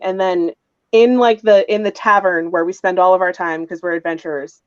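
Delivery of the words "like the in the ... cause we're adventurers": ordinary talk, nothing marked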